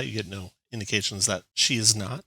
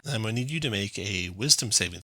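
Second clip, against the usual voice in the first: ordinary talk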